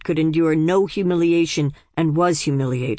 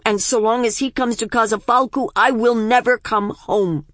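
impassioned refusal; 6 semitones higher, 3 dB louder than usual. Acted.

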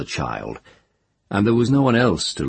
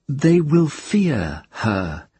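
slow solemn quote; 9 semitones higher, much slower than usual. Retold or imitated. Tenar quoting old Ogion.

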